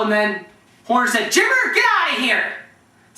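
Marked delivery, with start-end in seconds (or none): 1.3-2.5 high-pitched, imitated dismissal; exasperated coach. Coach catches player listening in locker room, exasperated order. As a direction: imitate a coach chasing out an eavesdropper.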